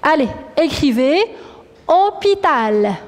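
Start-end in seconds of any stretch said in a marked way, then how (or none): 1.9-2.9 hôpital in long, slow syllables; slow dictation. Shown as a demonstration. Teacher repeats hôpital for pupils to write, slow dictation. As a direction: dictate hôpital slowly, stretching hô and tal.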